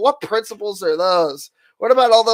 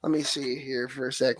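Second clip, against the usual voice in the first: normal talking voice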